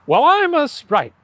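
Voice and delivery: sprite voice. Pretend. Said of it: "your impression of a sprite".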